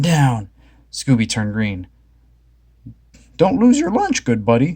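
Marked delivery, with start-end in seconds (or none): 3.4-4.8 higher Shaggy voice; lightly worried buddy. A narrator's reading. Shaggy urging seasick Scooby, lightly raised voice. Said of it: "Shaggy said".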